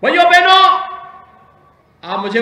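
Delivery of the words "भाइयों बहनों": loud, high call to the crowd. Real, not acted. Politician greets the rally crowd, loud high call.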